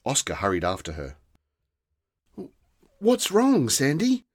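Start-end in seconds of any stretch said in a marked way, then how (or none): 3.0-4.2 lightly voiced worried boy, higher; a hint of a young boy asking gently; concerned boy. A narrator's reading. Boy asks slumped fairy, lightly higher.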